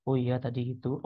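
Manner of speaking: "Oh iya" kept down, low-key; low intonation. Shown as a demonstration. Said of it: "tinggi sama rendahnya".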